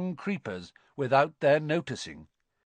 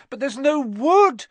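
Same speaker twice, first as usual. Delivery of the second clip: high, loud, panicked wail; crying out; 13 semitones higher, 8 dB louder than usual. A narrator's reading. Girl wringing her hands, crying out. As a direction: wail it helplessly. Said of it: "Hermione cried".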